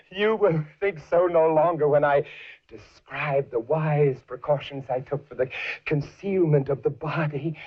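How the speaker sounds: ordinary delivery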